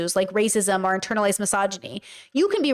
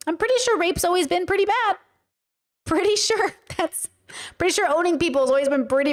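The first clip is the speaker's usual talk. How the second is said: incredulous sarcasm; 7 semitones higher than usual. Real, not acted. Sarcastic disbelief at pundits.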